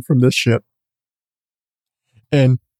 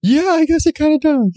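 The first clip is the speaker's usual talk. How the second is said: high, bemused agreement; amused nurse; 14 semitones higher than usual. Retold or imitated. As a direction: concede it lightly in her voice. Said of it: "She's like".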